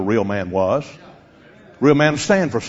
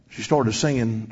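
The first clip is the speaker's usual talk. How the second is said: ordinary delivery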